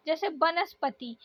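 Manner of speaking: ordinary talk, nothing marked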